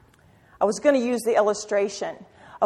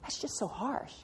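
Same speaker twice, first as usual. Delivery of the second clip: a hurt little gasp, then trailing off much softer; mock offended gasp; 3 semitones higher, 7 dB quieter than usual. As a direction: imitate a churchgoer taking offence. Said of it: "how many of you go".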